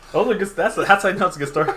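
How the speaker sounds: fast words pushed out through real laughter; laughing hard while talking to friend about his story, laughing fast. Real, not acted. Real laughter breaking through the words.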